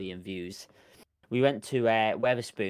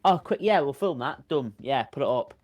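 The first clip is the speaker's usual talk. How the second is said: higher, louder carefree rush; breezy quick take; 5 semitones higher, 5 dB louder than usual. Retold or imitated. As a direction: toss it off like it's nothing. Acting out a quick careless filming.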